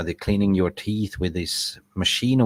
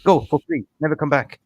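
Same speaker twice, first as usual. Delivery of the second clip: angry dentist; 6 semitones higher, 4 dB louder than usual. Retold or imitated. Dentist voiced ordering him out.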